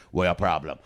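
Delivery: Jamaican patois character. Retold or imitated. Comic plays his Jamaican character to a heckler, Jamaican patois. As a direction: stay in the patois character, deep. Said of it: "I just stayed in character".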